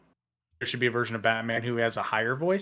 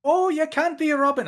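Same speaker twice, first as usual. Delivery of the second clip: put-on high Irish-accented Batman; mock Irish Batman; 14 semitones higher than usual. Irish Batman turning down a would-be Robin, high. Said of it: "I don't know why he's Irish".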